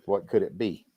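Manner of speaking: ordinary talk, nothing marked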